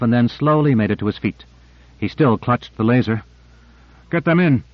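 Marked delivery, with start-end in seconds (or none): 4.1-4.6 mild higher-pitched call; restrained raised call. A narrator's reading. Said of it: "Owens called".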